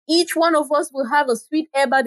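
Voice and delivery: high, excited chorus of women; women crying out. A narrator's reading. Eager women calling out their delight together. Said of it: "the women cried out".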